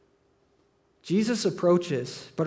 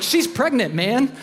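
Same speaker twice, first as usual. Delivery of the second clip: mock yell at friend; 6 semitones higher, 4 dB louder, slower than usual. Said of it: "we're just yelling at Bill".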